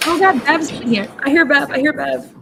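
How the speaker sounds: high-pitched excited squeal. Real, not acted. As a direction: squeal it out, thrilled. Reacting to courtroom stream.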